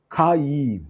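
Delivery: ഖയീം drawn out, kha-yeem, falling; pronunciation demo. Shown as a demonstration. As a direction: say ഖയീം with a long final eem. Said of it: "Hebrew വേർഡാണ്". (ഖയീം നീട്ടി, താഴ്ന്നവസാനിപ്പിച്ച്)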